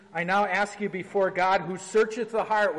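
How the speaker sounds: measured, solemn reading of the rite; formal liturgical reading. Acted. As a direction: read it like a pastor at the altar. Reads liturgy text aloud.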